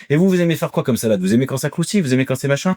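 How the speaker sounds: high hawker voice tossing questions at the crowd; market demonstrator. Retold or imitated. Vendor quizzing the audience about salads, high-pitched patter. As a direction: engage shoppers like a stall salesman. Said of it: "ils t'interpellent dans le public".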